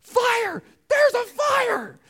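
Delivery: high, loud, slow panicked shout; frantic yell. Retold or imitated. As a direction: yell the alarm down the phone in panic. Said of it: "And he's like".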